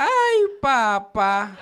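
loud, slow, drawn-out "Ay, papá"; girlfriend's "ay, papá". Retold or imitated. Every time she laughs, a loud drawn-out imitation. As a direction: belt a long "Ay, papá" as she did.

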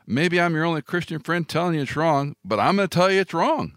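ordinary talk, nothing marked

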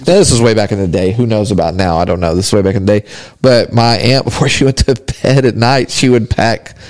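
ordinary talk

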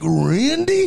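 disbelieving. Retold or imitated. Caller repeating disbelieving.

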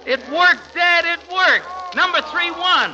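thrilled. Acted. Son telling his dad the bet won, thrilled. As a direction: cry out in delight at the win.

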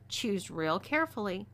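plain reading voice

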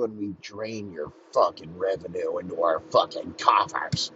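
whispered, gloating villain aside; sinister whisper. Retold or imitated. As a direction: hiss the secret plan like a schemer.

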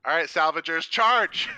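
high, loud rallying yell; battle cry. Pretend. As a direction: rouse the troops into a charge. Rallying the squad.

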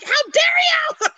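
high, drawn-out cry of fake indignation; mock outraged yelling. Podcaster mimics defenders of the classics, mock outraged yell. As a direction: wail it like a scandalized purist. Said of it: "there's crying and there's yelling".

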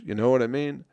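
ordinary talk

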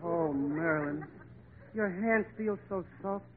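slow dreamy lovestruck voice; smitten and soft. Acted. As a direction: melt over her hands. Smitten with Marilyn.

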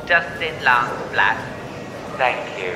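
slow, loud tannoy call of a name; airport announcement. Acted. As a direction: page the passenger at the gate. Mock final boarding call.

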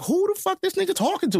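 high, indignant disbelief; shocked outrage. Retold or imitated. Nephew stunned after uncle's outburst, high indignant disbelief. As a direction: sound stunned that anyone would talk to you that way. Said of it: "I'm in shock".

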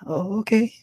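very high, rising okay; uneasy agreement. Retold or imitated. Man to forward New York woman, uneasy high reply. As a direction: squeak out a hesitant yes. His startled reply to her come-on.

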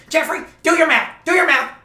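high, loud mock mom shout; mom yelling. Fed-up mom yelling at her son to do his math. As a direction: bark it at the kid like a fed-up mom. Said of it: "his mom comes in every few seconds, like".

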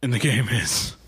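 a hint of a creepy Jigsaw whisper; lightly voiced horror villain game master. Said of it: "It's Saw, all right? It's Jigsaw".

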